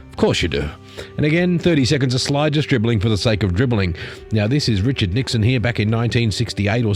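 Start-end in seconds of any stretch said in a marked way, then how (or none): none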